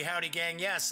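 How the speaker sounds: ordinary delivery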